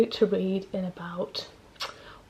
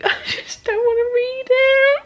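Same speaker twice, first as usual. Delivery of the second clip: high, tearful laughing whine; half laughing, half crying; 5 dB louder, slower than usual. Real, not acted. Dreading a sad chapter.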